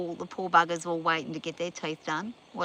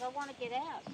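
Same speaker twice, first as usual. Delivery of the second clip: lightly put-on, higher horse voice; voicing the horse; 6 semitones higher, 11 dB quieter than usual. Owner voicing her horse, a light higher pretend voice. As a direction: pretend the horse is speaking for herself.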